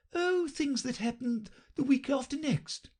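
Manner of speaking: high, offhand toss-off; careless. A narrator's reading. The Queen answering Alice, careless. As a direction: throw it away lightly. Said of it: "in a careless tone".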